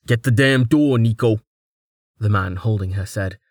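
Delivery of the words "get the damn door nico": annoyed. Acted. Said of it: "his voice more annoyed than strained".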